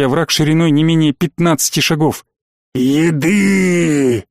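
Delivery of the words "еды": monster growl. A narrator's reading. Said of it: "Рык чудовища".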